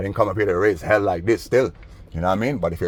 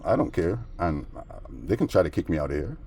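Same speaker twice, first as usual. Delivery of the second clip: normal talking voice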